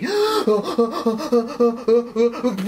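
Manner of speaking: long run of high, rapid gasps; panicked gasping. Acted. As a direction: hyperventilate theatrically. Acting out overload.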